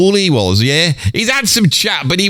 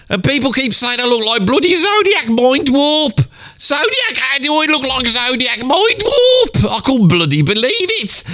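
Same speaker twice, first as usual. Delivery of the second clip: pitched way up, outraged and disbelieving; indignant outrage; 9 semitones higher than usual. Acted. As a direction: bristle at the insult, voice shooting higher. Outraged at comments comparing him.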